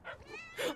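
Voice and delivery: high, sharp gasp; shocked gasp. Real, not acted. Gamer startled by what appears on screen, shocked gasp.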